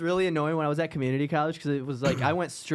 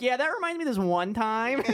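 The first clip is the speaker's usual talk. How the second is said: annoying veteran; 8 semitones higher than usual. Retold or imitated. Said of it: "I'm like".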